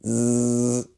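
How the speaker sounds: long steady "zzz" buzz; buzzing sound. Shown as a demonstration. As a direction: buzz like a bee. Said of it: "start with a buzzing sound like".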